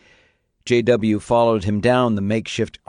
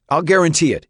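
desperate; 7 semitones higher, 4 dB louder, faster than usual. Acted. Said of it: "his voice desperate".

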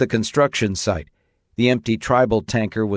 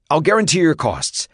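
desperate; 7 semitones higher than usual. Acted. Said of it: "his voice desperate".

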